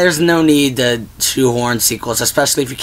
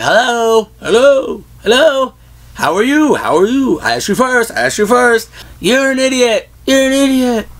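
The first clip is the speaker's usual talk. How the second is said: Grinch echo; 10 semitones higher than usual. Retold or imitated. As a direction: yell up high and trade lines with your own echo. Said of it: "He's like".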